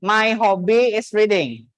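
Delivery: "My hobby is reading" slowly, word by word; deliberate classroom drill; slow repeat-after-me model. Shown as a demonstration. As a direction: stretch "My hobby is reading" out for a learner to copy. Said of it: "Repeat after me".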